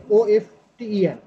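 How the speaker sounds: letters ওএফটিইএন spelled slowly one by one. Shown as a demonstration. Teacher spells out often for the student, slowly. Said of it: "আবার often".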